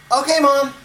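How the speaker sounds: put-on high, loud eager child's "okay"; boy agreeing to his mother, high and keen; little boy voice. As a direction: chirp it back like a keen six-year-old. Boy answers his mom.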